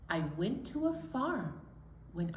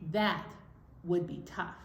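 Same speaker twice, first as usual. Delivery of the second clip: ordinary delivery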